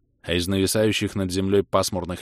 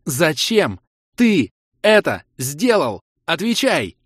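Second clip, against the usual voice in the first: slow, loud, high shout word by word; high, drawn-out furious demand; enraged demand; 7 semitones higher, 6 dB louder, slower than usual. A narrator's reading. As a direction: hammer each word out in fury. Kira demands answer in rage.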